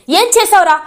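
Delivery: high, loud furious accusation; angry shout. Retold or imitated. Mahendra grabs Shailendra's collar, furious shouted accusation. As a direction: confront him, yelling. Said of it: "గట్టిగా అడుగుతాడు".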